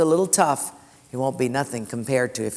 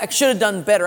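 self-punishing regret; 5 semitones higher, faster than usual. Retold or imitated. The grieving person punishing himself, mock anguish. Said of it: "self-inflicted penance".

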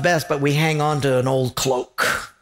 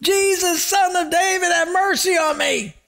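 much higher desperate shout; yelling all the more; 14 semitones higher than usual. Retold or imitated. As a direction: shout it even harder, desperate. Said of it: "he yelled out all the more".

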